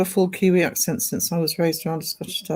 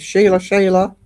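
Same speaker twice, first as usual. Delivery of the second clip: put-on Seg's Aussie accent; 3 semitones higher, 6 dB louder than usual. Streamer imitating a friend's Aussie accent for viewers. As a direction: mimic an Australian saying it, pitched up. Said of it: "does the Aussie accent when he says".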